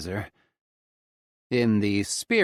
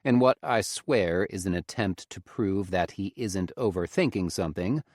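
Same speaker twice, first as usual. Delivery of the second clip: ordinary delivery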